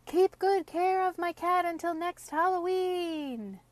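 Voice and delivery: high, far-off witch call. A narrator's reading. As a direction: shout down from the sky. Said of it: "called the witch".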